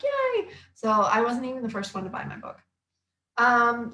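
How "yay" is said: very high squealed cheer; excited yay. Retold or imitated. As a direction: squeal it like a happy kid. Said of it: "I'm like".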